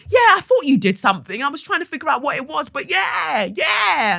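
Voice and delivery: high-pitched mock enthusiasm; imitated eager agreement. Teacher answering the reception guy, high mock-eager agreement. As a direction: agree over-keenly, pitch lifted.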